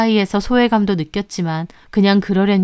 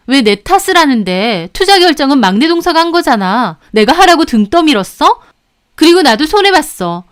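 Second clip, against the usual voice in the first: loud, higher defensive retort; incredulous indignation; 7 semitones higher, 7 dB louder than usual. A narrator's reading. Second sister-in-law defends herself, incredulous and indignant. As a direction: throw the blame back in disbelief. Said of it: "어이없어 하고요".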